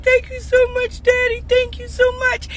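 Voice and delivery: high, tearful thank-you in the daughter's voice; imitating crying daughter. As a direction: squeak it out like a sobbing teen.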